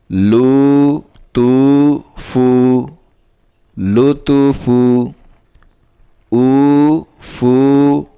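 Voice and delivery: measured, chanting recital of spelled syllables; recitation drill. Shown as a demonstration. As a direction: read each syllable out slowly like a teacher drilling a class. Revision recital of first line. (ধীরে ধীরে সুর করে বানান পড়া)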